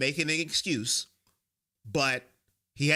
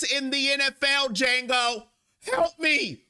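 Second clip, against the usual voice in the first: high-pitched venting rant, raised well up; real frustrated yelling; 8 semitones higher than usual. Real, not acted. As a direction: yell it at the chat in real frustration. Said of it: "That's why I'm yelling at Django".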